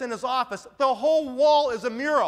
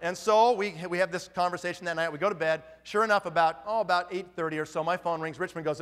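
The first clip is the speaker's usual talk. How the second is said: ordinary talk, nothing marked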